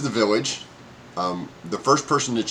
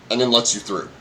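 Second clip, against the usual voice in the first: normal talking voice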